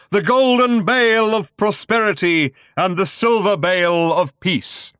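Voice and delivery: high and loud, swelling to the peak; building to a climax. A narrator's reading. Showman booms toward the climax of his pitch. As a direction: ride it up to the climax. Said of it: "approaching its climax".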